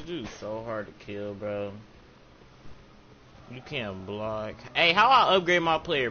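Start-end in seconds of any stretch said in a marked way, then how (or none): 4.7-6.1 raised call. Real, not acted. Calling out question mid-game.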